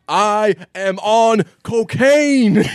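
high, drawn-out holler; loud, stretched-out mock yell; retold yell. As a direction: imitate a man yelling it out, word by word. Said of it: "and just yells".

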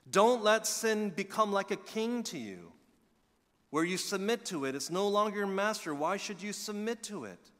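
loud, high preaching build; preaching at full force. Acted. Preacher urging church not to let sin rule, loud and high. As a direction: thunder the warning at the congregation.

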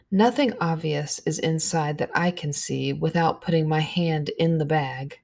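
ordinary delivery, nothing marked

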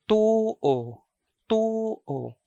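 tuo with long U, then a normal oo; long first vowel. Shown as a demonstration. Teacher showing the word for believe, tuo with long vowel. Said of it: "the first vowel is a long vowel".

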